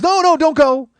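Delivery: high, loud cry to stay; frantic calling out. Retold or imitated. Haggling kid begging the wife not to drive off, frantic shout. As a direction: shout to stop them leaving.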